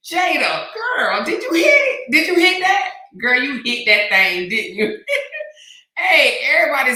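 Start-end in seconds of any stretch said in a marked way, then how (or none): 0.1-5.0 loud high teasing; teasing shout. Real, not acted. Teasing Jada about hitting it, loud and gleeful. 5.0-5.7 high squealing laugh. Real, not acted. Laughing while teasing Jada, high squeal.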